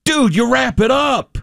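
high-pitched mock exclamation; insistent buddy. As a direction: play the buddy pushing back hard. Acting buddy's insistent comeback.

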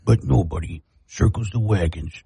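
dramatic TV sports-anchor line; Chris Berman. Retold or imitated. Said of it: "when I was trying to do Chris Berman".

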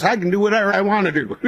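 high, fast, mock-defiant retort; defiant wife. Wife retorting to her husband, high and defiant. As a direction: toss it back like a stubborn wife.

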